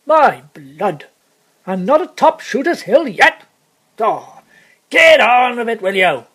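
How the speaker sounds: loud, irritated coachman urging on his horses; exasperated shouting. Acted. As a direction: explode in vexation at the team.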